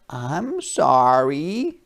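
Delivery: put-on slow, small child apology; small sorry child voice. A narrator's reading. Harriet apologizing to her mother, meek and slow.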